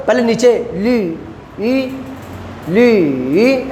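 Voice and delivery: teacher demo of ली in third tone. Shown as a demonstration. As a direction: draw out ली down then up. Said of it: "ऐसे पढ़ना है third tone को".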